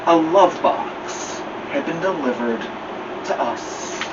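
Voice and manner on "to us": ordinary delivery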